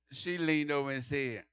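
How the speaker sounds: ordinary delivery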